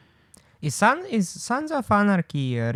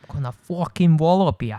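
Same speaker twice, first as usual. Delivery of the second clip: playful stage-Irish threat; put-on Irish accent; 3 semitones higher than usual. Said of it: "got you to do the accents".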